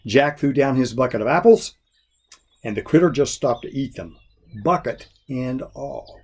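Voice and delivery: ordinary delivery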